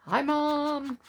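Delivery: high, bright "Hi, Mom" of the son; son barging in. Retold or imitated. Son walking in on the recording, high and cheery. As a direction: breeze in cheerily. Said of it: "guns a-blazing".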